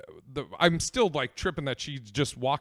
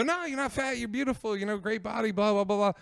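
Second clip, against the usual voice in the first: high placating voice; 7 semitones higher than usual. Retold or imitated. Placating her to escape.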